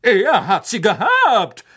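slow, loud, high boast with a laugh; proud knight. A narrator's reading. Sir Cadogan proudly answering McGonagall, slow and loud. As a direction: puff up with pride. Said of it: "sagte Sir Cadogan stolz".

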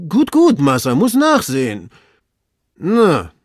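high, loud reply from above; obliging, puzzled servant. Acted. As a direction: call down the curious discovery. Servant inspects and reports.

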